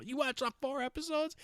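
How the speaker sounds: high incredulous imitation; mock incredulous. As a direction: squeal it in shocked disbelief. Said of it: "She got all".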